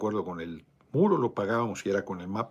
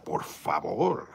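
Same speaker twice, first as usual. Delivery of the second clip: scornful drawl; 4 semitones lower, much slower than usual. Retold or imitated. Desafío burlón ante quitar la visa.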